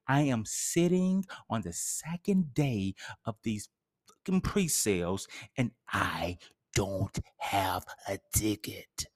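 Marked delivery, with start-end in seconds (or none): 5.6-9.1 whisper. Acted. Whispered self-pity.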